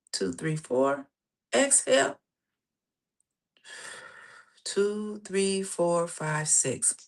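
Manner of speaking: measured count with long pauses for breath; guided breathing count. Acted. Coach leading listeners through breathing, guided breathing count. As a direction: pace the breath, letting each count settle.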